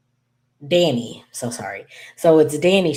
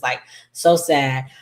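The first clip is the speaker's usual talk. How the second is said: ordinary talk, nothing marked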